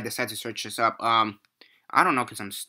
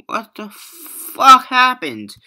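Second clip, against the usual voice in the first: loud, high, slow cry of disbelief; exasperated yell; 12 semitones higher, 10 dB louder, much slower than usual. Real, not acted. Reviewer venting about two actresses he liked, slow loud yell. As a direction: yell it at the screen in total disbelief. Outraged at the wasted cast.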